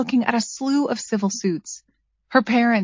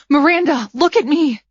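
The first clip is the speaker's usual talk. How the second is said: higher, louder frantic plea; desperate pleading; 5 semitones higher, 4 dB louder than usual. A narrator's reading. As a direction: beg her to stay with you. Begging dying friend to respond.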